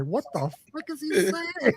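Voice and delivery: high mock-bewildered voice; high, incredulous mock voice; voicing her reaction. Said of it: "Her response was like".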